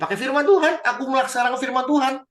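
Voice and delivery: high, loud mock-pious boast. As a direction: ham up the king bragging about obeying. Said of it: "Luar biasa kan ngomongnya". (pamer sok saleh, tinggi dan keras)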